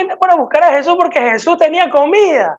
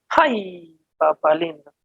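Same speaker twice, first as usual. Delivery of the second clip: ominous warning; 8 semitones lower, 7 dB quieter, slower than usual. Acted. Warning of the final judgment.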